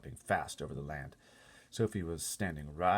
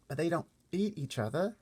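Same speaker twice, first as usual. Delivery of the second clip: put-on higher, small girl voice; little girl Sophie; 12 semitones higher, 5 dB louder than usual. Sophie asking the giant about the other giants, small high girl voice. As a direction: speak up like a worried little girl.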